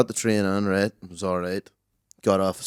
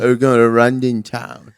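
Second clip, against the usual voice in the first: slow, put-on English accent; mock accent; 2 semitones higher, 5 dB louder, slower than usual. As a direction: drawl out Runden town in a jokey accent. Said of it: "talking like that the whole time".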